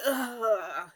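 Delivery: imitating a drawn-out groan of pain; mock pained "ugh". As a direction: groan it out as if hurting. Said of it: "he sounds like he's in pain".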